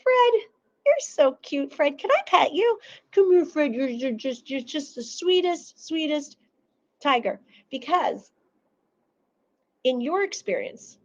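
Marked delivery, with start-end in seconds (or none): 0.0-7.4 high sweet cooing to a tiger; baby-talk a cute pet; high doting pet voice. Retold or imitated. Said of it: "you're like".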